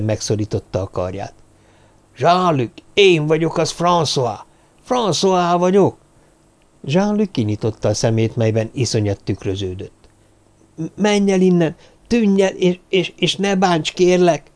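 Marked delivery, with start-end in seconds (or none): 2.2-6.0 high-pitched, insistent appeal; urgent insisting. Acted. François kneels by terrified Jean-Luc, urgent insisting. Trying to get through to friend. 10.8-14.5 high, stammering plea; terrified pleading. Acted. Jean-Luc begs the masked figure, terrified pleading. Terrified boy begging not be hurt.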